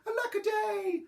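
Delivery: high storybook lament; cried out. A narrator's reading. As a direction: bemoan it up high. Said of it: "they cried".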